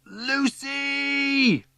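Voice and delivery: very high, drawn-out shout for someone; yelling. A narrator's reading. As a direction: yell the name across the playground. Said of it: "yells Strawberry".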